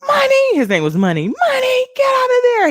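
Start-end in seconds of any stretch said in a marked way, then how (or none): 0.1-0.5 high, loud call of the dog's name; yelling dog's name. Retold or imitated. Owner yelling at her dog Money, loud call. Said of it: "it was always like". 1.3-2.7 high, loud scolding call; scolding yell. Retold or imitated. Owner yelling at her dog Money to get out. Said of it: "it was always like".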